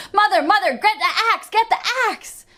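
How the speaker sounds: high, loud urgent call. A narrator's reading. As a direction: yell down to mother in panic. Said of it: "begins to call out".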